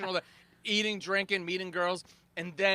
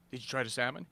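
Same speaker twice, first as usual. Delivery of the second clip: lower, softer innocent question; 7 semitones lower, 6 dB quieter, faster than usual. Retold or imitated. Crasher dodging the angry CEO, meek. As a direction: play dumb, mild and low. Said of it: "I go".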